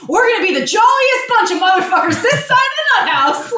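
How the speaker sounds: manic high jolly rant with a laugh; high laughing Chevy Chase dad voice; Chevy Chase impression. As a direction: do the frantic holiday dad. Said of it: "It's like Chevy Chase".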